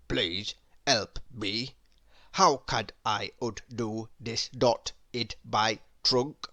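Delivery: funny elephant voice. A narrator's reading. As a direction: plead through a knotted trunk. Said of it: "in a funny sort of way".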